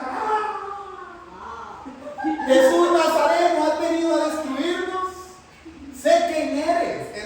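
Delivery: high, slow, drawn-out demon cry; screaming demons. Acted. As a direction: howl at Jesus like a terrified demon. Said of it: "Y empiezan a gritar".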